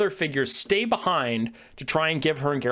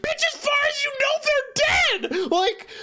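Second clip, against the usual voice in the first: exasperated outburst. Retold or imitated. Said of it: "And I'm like".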